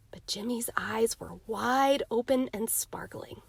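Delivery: ordinary delivery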